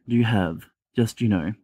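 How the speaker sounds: usual speaking voice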